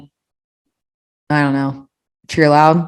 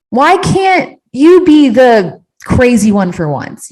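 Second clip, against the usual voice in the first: exasperated plea to husband; 7 semitones higher, 4 dB louder, slower than usual. Retold or imitated. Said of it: "I'm like".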